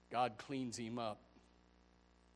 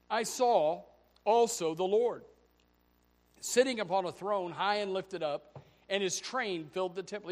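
loud, higher preached scripture; preaching reading; 8 semitones higher, 10 dB louder than usual. Real, not acted. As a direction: proclaim the verse with rising force. Preaching the vision text loudly.

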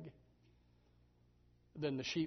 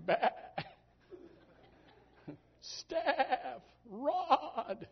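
high bleating sheep voice calling out; a sheep baaing for the shepherd; high sheep cry for rod and staff; 13 semitones higher, 8 dB louder, much slower than usual. Pretend.

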